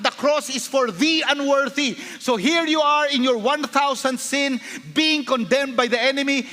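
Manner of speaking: high, impassioned sermon delivery; full-force preaching. Real, not acted. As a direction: urge the congregation with rising fervor.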